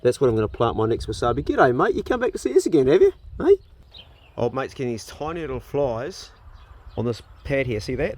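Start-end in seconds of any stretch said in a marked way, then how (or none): 1.4-3.6 lifted, friendly pet-talk greeting; talking to a bird. Real, not acted. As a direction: chat sweetly to the little bird.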